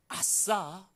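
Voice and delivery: a bright little whoop of joy; mock cheer. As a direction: cheer at the chance to grow. Joyful exclamation in test. (신난 듯 가볍게 외치는 "아싸")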